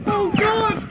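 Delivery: high-pitched laughing oh God; laughing groan. Real, not acted. Co-host laughing at the embarrassing story, high squeals.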